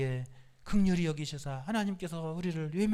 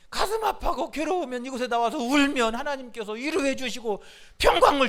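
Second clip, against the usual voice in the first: raised, insistent pulpit voice; exhorting preacher; 7 semitones higher, 8 dB louder than usual. Real, not acted. Preacher asking congregation why they won't come, exhorting. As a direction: press the question with loud, high surges.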